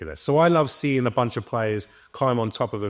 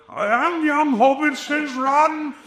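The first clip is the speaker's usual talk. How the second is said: much higher, very slow, grand wizard voice; hammed-up Gandalf barking callouts; Gandalf impression; 5 dB louder, slower than usual. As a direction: draw out each word like an ancient wizard giving orders. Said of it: "we can both do the voices".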